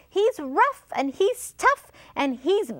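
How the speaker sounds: high, dramatic mouse warning; high-pitched build-up to a big reveal; storybook mouse. A narrator's reading. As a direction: stress each trait in a high mouse voice. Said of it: "said the mouse".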